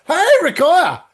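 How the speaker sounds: loud, high joyful hello with a laugh; the host greets Rakaya as she walks in, loud and delighted; delighted greeting. Real, not acted. Calling out in real delight.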